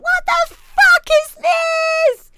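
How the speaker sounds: high, slow, stretched-out disbelief; baffled outburst. Retold or imitated. Radio host baffled by an unknown dance track. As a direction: drag it out in a high squeal of confusion. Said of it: "I was like".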